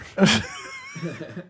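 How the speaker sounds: very high, airy giggle; squealing laugh. Real, not acted.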